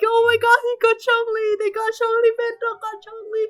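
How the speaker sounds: high, loud, repeated shrieks; frantic high-pitched fan screaming; excited screaming. Retold or imitated. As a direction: squeal the amazing news over and over. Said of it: "It's just me screaming half the time".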